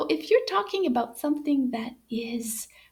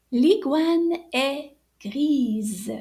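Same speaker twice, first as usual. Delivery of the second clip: very slow, every syllable laid out, grise ending on a clear z; teacher drawing out grise so the z is heard; slow model sentence; 4 dB louder, much slower than usual. Shown as a demonstration. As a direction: spell out grise with a buzzing z at the end. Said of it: "We're making it sound like a Z".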